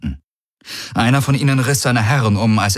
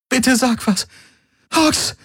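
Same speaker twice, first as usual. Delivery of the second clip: very high, desperate begging; pleading. A narrator's reading. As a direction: beg for an answer, pitch climbing high. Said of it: "flehte Kirai".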